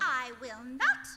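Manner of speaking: loud, slow, firm no; angry refusal. Acted. Wife refuses the floor manager's order, loud and slow. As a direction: dig in and refuse each word.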